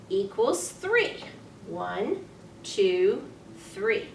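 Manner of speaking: ordinary delivery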